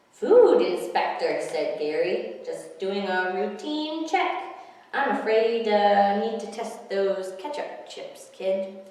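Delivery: loud, slow official voice; pompous inspector. Acted. Gary in disguise bluffing the kid, slow and loud. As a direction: bluff like a stern food inspector.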